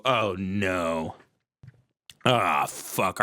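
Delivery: low, sinking groan of dismay; imitated dread. Husband realizing who else is coming, low dread. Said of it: "she looks at you and you go".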